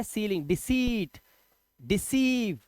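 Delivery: सीलिंग, डिसीट, डिसीव with slow stretched "see"; long ee sound. Shown as a demonstration. Teacher reading EI examples सीलिंग, डिसीट, डिसीव, long ee. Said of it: "बड़ी वाला".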